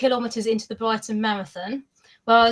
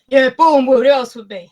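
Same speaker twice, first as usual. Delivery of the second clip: a retold proud call, clearly louder; proud loud answer; 2 semitones higher, 11 dB louder than usual. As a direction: call it out like a proud Boreham Wood fan.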